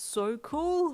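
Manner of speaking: high, loud, slow wonder; drawn-out amazed delight; excited. A narrator's reading. Father marvels at his son's quirk, slow and excited. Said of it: "Hawks said with excitement".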